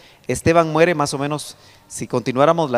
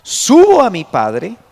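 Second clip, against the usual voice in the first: slow, louder, solemn declaration; solemn Jesus; 2 semitones higher, 7 dB louder, much slower than usual. Acted. Preacher voicing Jesus sending word to the disciples, slow and solemn. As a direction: proclaim it slowly like the risen Christ. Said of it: "Pero ve a mis hermanos y diles".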